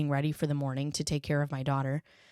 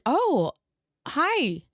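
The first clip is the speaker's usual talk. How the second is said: higher, louder surprised hello; startled greeting; 10 semitones higher, 4 dB louder than usual. Retold or imitated. Mother startled seeing her daughter's eyes. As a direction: caught off guard, masking shock. Mother's shocked reaction retold.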